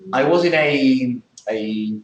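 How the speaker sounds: "a" said as a drawn-out "ay" while pausing for a word; hesitation "ay". Shown as a demonstration. Teacher showing a pause after the article. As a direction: stop on a long "ay". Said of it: "So you say a".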